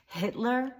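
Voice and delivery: normal talking voice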